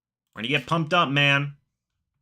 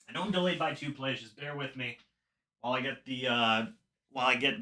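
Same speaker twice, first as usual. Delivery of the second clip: quiet aside; 3 semitones lower, 10 dB quieter, faster than usual. Real, not acted. Muttering while setting up TV.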